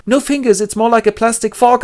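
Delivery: pained Winston. Acted. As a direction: squeeze it out in pain. Winston answering.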